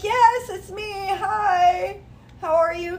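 high, bright chirpy hello; chirpy greeting. Retold or imitated. Answering the fan with a chirpy high hello. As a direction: greet him sweetly up high. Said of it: "I was like".

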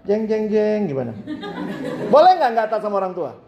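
playful dramatic sting, then a quick laughing question. Real, not acted. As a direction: tease the crowd with suspense. (jeng jeng jeng sambil tertawa)

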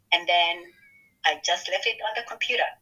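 ordinary talk

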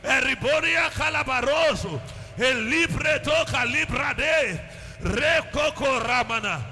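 steady, rhythmic tongues prayer; praying in tongues. Acted. Pastor opening the service praying in tongues, fervent and rhythmic.

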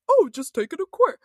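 mocking dismissive voice. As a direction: toss it off like a smug critic. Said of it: "for those of you out there like".

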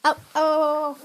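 ow ow ow. Real, not acted. Brother hurting her.

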